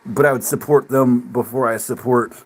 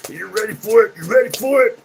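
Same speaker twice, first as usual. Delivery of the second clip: higher, teasing taunt, rising each time; mock taunting; 9 semitones higher than usual. Democrats mocked as a pushy partner taunting. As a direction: ham up a pushy tease.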